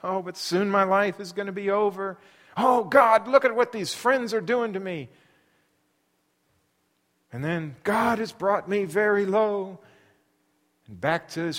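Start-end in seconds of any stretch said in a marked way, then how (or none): 0.0-5.1 higher, louder anguished plea; crying out to God. Acted. Job pleading aloud with God against his friends, anguished cry. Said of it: "he cries back out to God". 7.8-9.8 lament. Acted. Job lamenting.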